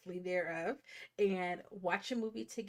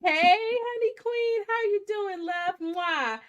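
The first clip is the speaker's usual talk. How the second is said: high, loud, drawn-out hello; excited greeting; 12 semitones higher, 10 dB louder, slower than usual. Real, not acted. Host greets a regular joining the livestream, gushing excitedly. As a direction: call out big and high to a dear regular, ending in a kiss.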